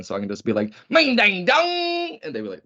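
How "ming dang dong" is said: high, slow fake Mandarin gibberish; mock Mandarin. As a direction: ham up a fake Mandarin accent, dragging it out. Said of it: "like, Mandarin or something".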